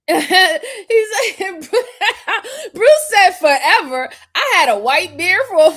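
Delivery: bursting into loud laughter mid-sentence; can barely get the words out for laughing. Real, not acted. Host laughing hard at a viewer's chat comment.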